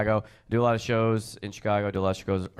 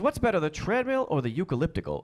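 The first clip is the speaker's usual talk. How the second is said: higher-pitched clueless customer, imitated; dumb question; 8 semitones higher than usual. Somebody asking at the gym, mock-clueless question. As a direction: ask it in earnest, pitched up. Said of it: "questions are so dumb".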